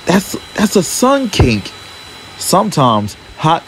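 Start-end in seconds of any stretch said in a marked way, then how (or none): 0.1-1.7 shocked. A narrator's reading. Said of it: "he gasped".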